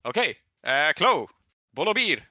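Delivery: usual speaking voice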